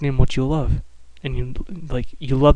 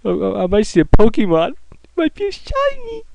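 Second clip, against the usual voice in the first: high squeal rising to a tiny squeak; squeaky excited hope; 9 semitones higher than usual. Pretend. Player in tall grass hoping for a shiny, high squeaky excitement. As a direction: squeal like a kid spotting a shiny.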